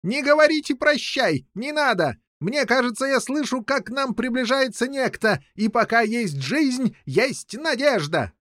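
high, urgent king's voice; crying out. A narrator's reading. King urging captives to keep hope, high cry. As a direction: plead in a high, carrying voice. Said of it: "закричал из своей камеры король Пеленор".

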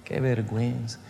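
subdued tearful lament, fading with each repeat; retold weeping prayer. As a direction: re-enact ashamed crying, softly. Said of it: "Y yo lloraba y le decía".

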